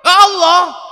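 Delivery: exasperated shout. Acted.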